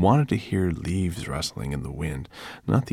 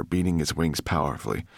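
usual speaking voice

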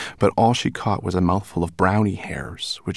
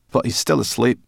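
brownie voice; 5 semitones higher, 5 dB louder than usual. A narrator's reading. Brownie objects.

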